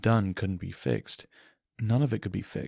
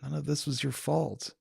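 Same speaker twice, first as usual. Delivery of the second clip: usual speaking voice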